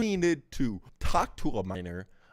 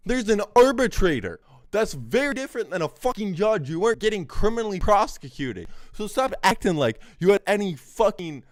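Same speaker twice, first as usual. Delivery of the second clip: loud, furious shouting at the screen; angry rant, voice raised high; 5 semitones higher, 9 dB louder than usual. Real, not acted. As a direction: yell down the lie, fed up. Ranting at streamer's clip.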